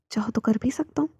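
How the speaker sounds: whispered, playful and close; hushed flirty offer; teasing whisper. Acted. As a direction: tease him in a hush. Teasing offer in intimate scene.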